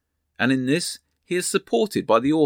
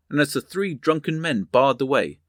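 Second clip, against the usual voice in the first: usual speaking voice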